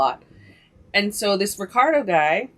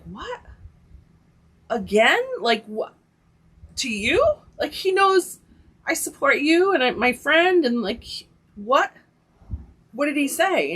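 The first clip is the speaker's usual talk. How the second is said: higher-pitched, incredulous questioning; astonished, pitch jumping up on each question; retold disbelief; 7 semitones higher than usual. Telling how she reacted to the police chief's news, incredulous. Retelling shocked reaction to betrayal.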